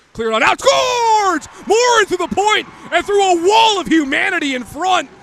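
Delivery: rapid hockey play-by-play; yelling a goal call; goal call shout. Real, not acted.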